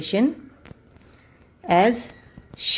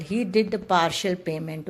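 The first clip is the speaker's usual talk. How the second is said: slow, careful example sentence; much slower than usual. Shown as a demonstration. Teacher reading a partial example to students, slowly. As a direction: read each word out slowly. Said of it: "partial को हम कह सकते हैं हिस्सा".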